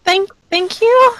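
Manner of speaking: high, slow, stammered thanks; flustered. Acted. Blushing character flustered by compliment.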